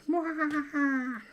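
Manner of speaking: high slow villain cackle; evil laugh. Acted. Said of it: "That was quite an evil laugh".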